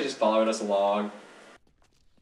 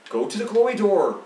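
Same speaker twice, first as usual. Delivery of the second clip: urgent urging; 6 semitones higher than usual. Pretend. Repeats the call, urging co-player on.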